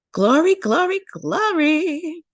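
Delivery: rejoicing call of glory; jubilant cry. A narrator's reading. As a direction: shout it up to the sky. Joyful cry at emancipation.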